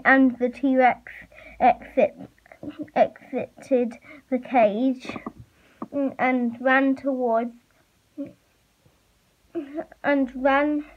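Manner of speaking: ordinary delivery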